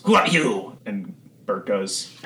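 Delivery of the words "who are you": higher-pitched, mildly alarmed challenge; lightly voiced startled cultist; challenging cultist. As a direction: challenge a stranger, a touch alarmed. Cultist confronts an intruder.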